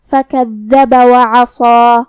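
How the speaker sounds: slow chant of فَكَذَّبَ وَعَصَى with held double dhal and thick s; heavy sad, long alif. Shown as a demonstration. Teacher models the rules, فَكَذَّبَ وَعَصَى with heavy drawn-out ending. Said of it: "صاد کو اور مد الف کو دونوں کو بھاری آواز میں".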